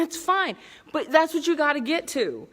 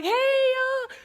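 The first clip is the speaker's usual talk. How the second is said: high, drawn-out greeting; playful call-out. Pretend. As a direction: call out high to a crowd of fans. Greets fans from atop haters' backs.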